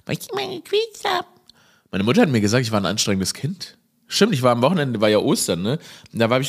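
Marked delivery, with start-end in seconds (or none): 0.1-1.2 very high, slow squeaky cartoon voice; Mickey Mouse voice. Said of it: "Hör auf mit deiner Mickey Maus Stimme". (sehr hohe, langsame Mickey-Maus-Stimme)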